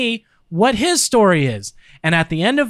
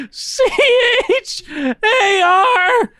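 slow shouted letters; 12 semitones higher, much slower than usual. Real, not acted. Spelling while cheeks pinched.

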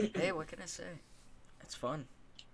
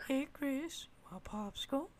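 very high cartoon voice; squeaky-high silly character, offering a treat; put-on character voice; 13 semitones higher than usual. Offering co-host a popsicle, high character voice.

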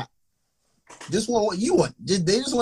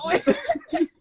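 high burst of laughter. Real, not acted.

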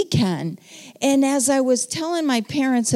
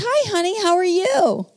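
much higher, lightly voiced elderly mother; mother's sweet greeting; 10 semitones higher than usual. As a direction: sound like a frail mom greeting her daughter, pitched well up. Mom voiced on the phone.

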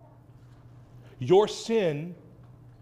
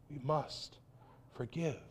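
softer "We must forgive.", almost under the breath; quiet echo; 5 semitones lower, 14 dB quieter than usual. Acted. Pastor repeating the call to his congregation, softer. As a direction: let "We must forgive." fall away softly, like a quiet echo.

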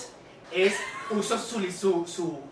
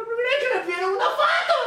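excited reveal; 13 semitones higher than usual. Real, not acted. Telling friends a stranger asked for a photo, excited. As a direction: burst out with the punchline. Punchline of the story.

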